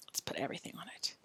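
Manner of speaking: quick whisper under the breath; whispered aside. Real, not acted. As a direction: mutter it to yourself fast.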